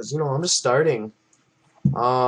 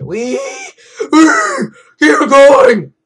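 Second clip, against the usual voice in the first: much higher, loud rallying yell; loud high cry to keep going; excited shout; 13 semitones higher, 12 dB louder, much slower than usual. Real, not acted. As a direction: shout to push on. Urging himself on mid-level.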